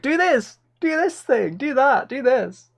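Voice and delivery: high mocking commands; barking orders in a high mock voice; bossy demanding voice. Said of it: "I'm like a monkey being poked".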